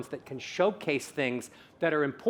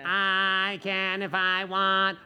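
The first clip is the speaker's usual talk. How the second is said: very slow, held on one tone; long stretched vowels; sustained voice exercise; 3 semitones higher, much slower than usual. Shown as a demonstration. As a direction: intone it as a vocal drill. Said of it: "More bassy".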